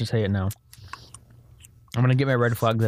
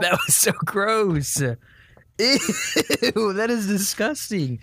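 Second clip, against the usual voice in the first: disgusted; 8 semitones higher, slower than usual. Real, not acted. Reacting to a gross fruit.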